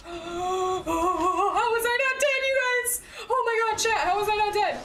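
high gasp of disbelief; real high-pitched astonishment; astonished. Real, not acted. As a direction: cry out high, amazed to be alive. Amazed to have survived in game.

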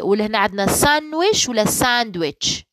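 usual speaking voice

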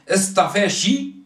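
اصطفاشي, the final ك said as شي, slowly; drawn-out اصطفاشي with ش for ك; dialect pronunciation. Shown as a demonstration. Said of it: "ينطقوا الك ش".

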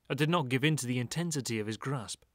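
ordinary delivery, nothing marked

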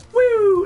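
loud, very high woo; shrieky celebratory whoop; excited whoop. Real, not acted. Whoops with the emote.

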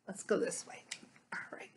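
soft unvoiced whisper; hush, as if not to disturb; real whispered aside. Real, not acted. Quietly moving to see Jesus.

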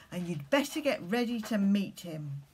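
ordinary delivery

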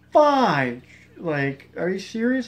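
loud, high burst of disbelief; shocked disbelief. Retold or imitated. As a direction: blurt it like you just realized your mistake. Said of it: "and I was like".

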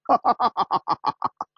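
high laugh trailing down in beats; big laugh. Acted. Winner gloating at turning luck, laughing. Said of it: "陆小凤大笑起来".

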